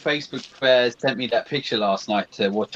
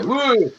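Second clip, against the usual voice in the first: high-pitched mock startle shout; sudden high whoop, playfully spooky; jump-scare whoa; 10 semitones higher than usual. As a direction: imitate someone leaping out with a whoa. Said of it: "he's gonna go".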